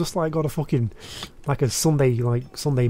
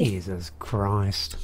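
exasperated sigh; 6 semitones lower, 5 dB quieter, much slower than usual. Real, not acted. Exasperated at losing.

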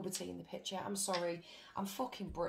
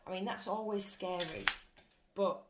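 ordinary talk

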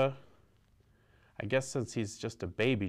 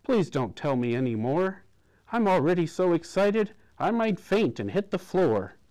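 louder and higher, flustered; sputtering; 7 semitones higher, 6 dB louder than usual. A narrator's reading. Della cutting off the doctor, sputtering. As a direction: blurt it out, cutting him off. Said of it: "Della sputtered".

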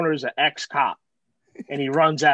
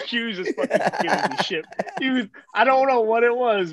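ordinary talk, nothing marked

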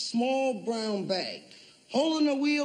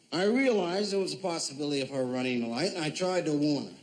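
normal talking voice

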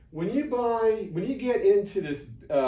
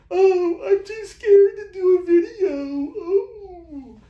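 high, loud, whimpering mock crying; mock whiny scaredy voice; 9 dB louder, slower than usual. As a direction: whine like a scared newbie, hammed-up. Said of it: "It's like".

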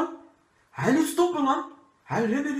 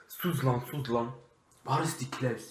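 hushed; 6 semitones lower, 6 dB quieter than usual. Acted. Burglar whispers to partner.

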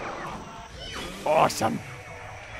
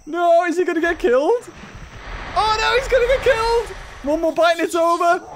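excited alarm; 7 semitones higher than usual. Real, not acted. Toast being mauled.

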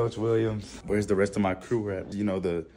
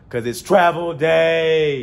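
slow, louder drawn-out catchphrase; catchphrase delivery; 7 semitones higher, 9 dB louder, much slower than usual. Pretend. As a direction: stretch it out like a signature line. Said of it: "You thought I forgot I was gonna say that".

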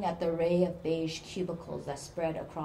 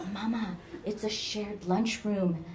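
ordinary delivery, nothing marked

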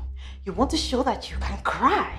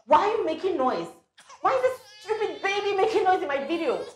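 annoyed scolding; 12 semitones higher, 8 dB louder than usual. Acted. Mother scolds noisy baby.

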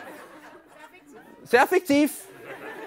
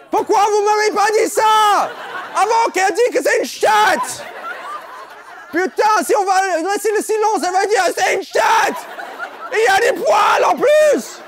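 mock outrage; 8 semitones higher, 4 dB louder than usual. Mock outrage at a crude joke.

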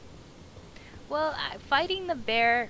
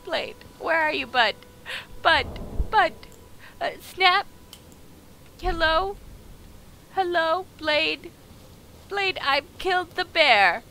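loud, higher calling out; 7 semitones higher, 8 dB louder than usual. Real, not acted. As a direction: call across the map for him. Calling out for lost companion.

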